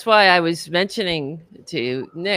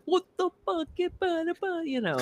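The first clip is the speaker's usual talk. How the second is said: much higher New York accent, mock angry; imitating her own angry New York accent; 14 semitones higher, 6 dB quieter, slower than usual. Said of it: "That's when my accent comes out".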